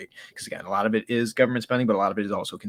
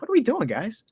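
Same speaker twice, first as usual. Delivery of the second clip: incredulous; 12 semitones higher than usual. Real, not acted. Mocking buyers at the top.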